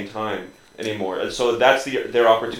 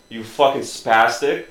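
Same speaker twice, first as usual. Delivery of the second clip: ordinary talk, nothing marked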